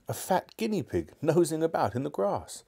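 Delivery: usual speaking voice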